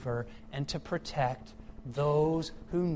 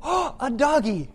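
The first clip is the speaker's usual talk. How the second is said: put-on higher, louder little kid squeal; excited child; 9 semitones higher, 9 dB louder than usual. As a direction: play the delighted toddler. Child voiced in story.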